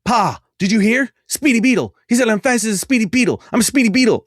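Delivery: put-on very high, quick, thrilled child voice; excited little kid. Kid boasting to his father, high and thrilled. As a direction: shout it to dad like a proud kid. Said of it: "whips around and goes".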